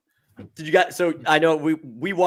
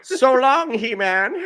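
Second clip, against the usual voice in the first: higher, louder hammed-up movie taunt; mock farewell line; 7 semitones higher, 5 dB louder, much slower than usual. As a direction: toss off a cheesy villain goodbye. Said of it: "one take like that".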